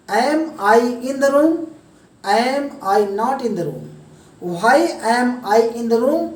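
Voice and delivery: very slow, every English word spaced out; slow demo. Shown as a demonstration. Teacher slowing drill sentences down for Hindi learners, slow. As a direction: spell each sentence out slowly for the students. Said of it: "मैं तो समझाने के लिए आपको slow बोल रहा हूँ".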